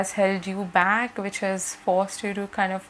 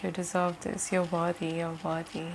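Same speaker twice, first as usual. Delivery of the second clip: softly repeated message; 3 semitones lower, 7 dB quieter than usual. Retold or imitated. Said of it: "I keep hearing".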